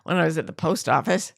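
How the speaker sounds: ordinary talk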